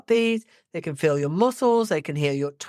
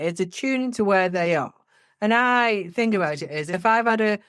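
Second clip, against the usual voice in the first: ordinary talk, nothing marked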